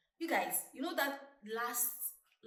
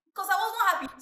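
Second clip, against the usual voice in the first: excited; 11 semitones higher, 11 dB louder than usual. Real, not acted. Laughing recollection.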